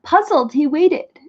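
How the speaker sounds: usual speaking voice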